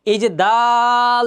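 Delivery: দাল held very long and loud; টান দিয়ে দাল; drawn-out dal. Shown as a demonstration. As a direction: stretch দাল out slowly. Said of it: "এখানে একটা টান দিয়েছি".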